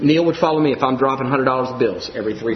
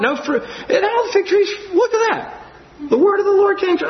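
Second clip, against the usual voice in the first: much higher, rising to an excited near-shout; amazed excited Peter; 10 semitones higher than usual. Acted. Peter marvelling at the withered fig tree, excited and high-pitched. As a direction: gush in disbelief that the curse came true. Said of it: "Or he could have said it like this".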